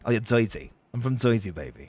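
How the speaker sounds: put-on low, soft Jersey accent. Joking about being from home turf, low Jersey accent. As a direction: lay on a low-key Joisey accent.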